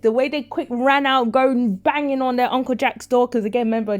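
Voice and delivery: usual speaking voice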